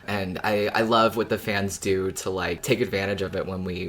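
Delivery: ordinary delivery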